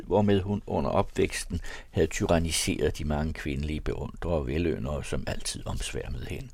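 ordinary delivery, nothing marked

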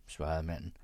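usual speaking voice

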